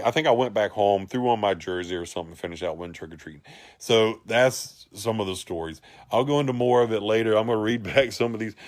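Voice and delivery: ordinary delivery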